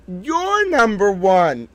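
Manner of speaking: high, very slow doting praise; doting coo. Real, not acted. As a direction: coo it to a little pet. Cooing to the emoji.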